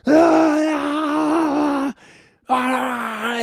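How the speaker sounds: retold high yelled ahs, half-acted; imitated screaming. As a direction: mimic the officer's sudden screaming fit.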